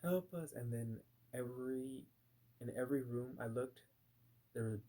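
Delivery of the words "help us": raised pitch, mock plea for mercy; retold cry for help. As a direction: imitate people calling out for help, not full-out. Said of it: "yelling for help".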